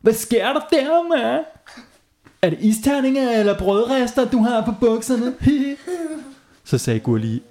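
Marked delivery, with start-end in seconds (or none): 0.0-5.3 high mocking kid voice; teasing shout. A narrator's reading. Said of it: "der råbte". 5.4-6.2 mocking laugh. A narrator's reading.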